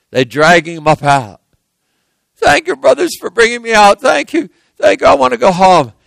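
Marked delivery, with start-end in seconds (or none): 2.4-5.9 very high, breathless-with-thanks victim voice; relieved pleading. Acted. Joseph thanking brothers as they pull him out, pleading relief. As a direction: gush thanks like a rescued boy.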